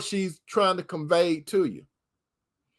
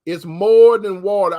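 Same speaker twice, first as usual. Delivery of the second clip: loud preaching emphasis; 8 dB louder than usual. Acted. Preacher stresses a key line.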